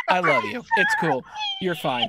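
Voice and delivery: high sing-song reassurance; reassuring. Real, not acted. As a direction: soothe her in a high playful voice. Reassuring the new player.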